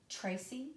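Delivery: accent the first syllable of Tracy; trochaic stress. Shown as a demonstration. Teacher demonstrating a trochee, Tracy accented on the first syllable. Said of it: "where you accent the first".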